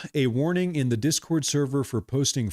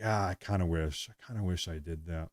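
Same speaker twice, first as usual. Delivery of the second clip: sighed-out private wish, much softer; wistful inner voice; 6 semitones lower, 9 dB quieter than usual. Acted. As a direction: trail off low and very soft. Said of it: "one percent in the back of my head that's like".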